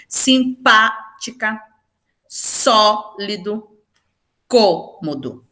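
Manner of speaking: simpática, sólido, cômodo with PA, SÓ and CÔ held long and strong; very slow examples, first stressed syllable of sólido and cômodo stretched, PA in simpática; stressed syllable drawn out. Shown as a demonstration. Teacher giving proparoxytone examples to students, stressed syllables drawn out. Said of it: "tenho como exemplos também aí".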